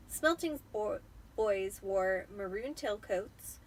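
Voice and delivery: plain reading voice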